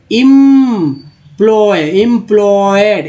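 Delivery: "Employed" slowly, with a long held "im"; drawn-out word building. Shown as a demonstration. Teacher assembling the word from em, slow drawn-out syllables. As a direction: stretch each part of "employed". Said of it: "ई एम है तो".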